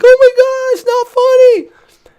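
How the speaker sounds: put-on very high, slow, tearful kid's whine; crying little brother. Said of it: "and his brother's crying".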